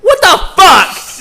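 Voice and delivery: loud, high, drawn-out disbelief; what the fuck, stretched in outrage; incredulous outburst. Real, not acted. Friend venting to a friend, loud disbelieving outburst.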